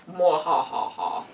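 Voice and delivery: sneering panto villain. Pretend. Evil laugh after zooming camera.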